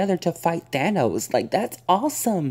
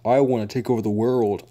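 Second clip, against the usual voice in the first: cliche villain voice; 4 semitones lower than usual. Retold or imitated. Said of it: "like, saying".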